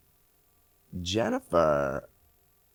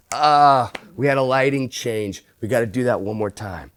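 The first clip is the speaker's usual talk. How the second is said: loud, higher mock outburst; mock director's complaint; 4 semitones higher, 8 dB louder than usual. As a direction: call it out like an exasperated director. Imagined director spoiling the take, retold.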